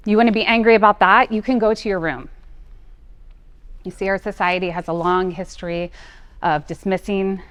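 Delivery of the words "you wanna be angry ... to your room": put-on louder, scolding parent sending a child to her room; mock angry parent. Speaker voicing a parent punishing an angry child, scolding. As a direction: snap it like a fed-up parent. Quoting harsh parental sayings.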